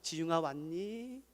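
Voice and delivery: soft, slow loving welcome; tender greeting. Retold or imitated. Old deaconess welcoming the boy at church, tender and slow. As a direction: greet him gently like a grandmother. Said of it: "늘 안아주셨어요".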